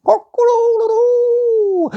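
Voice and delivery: very high, slow, loud rooster crow; cockerel crow. Acted. Cockerel waking everybody, high loud crowing. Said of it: "crowed really loudly".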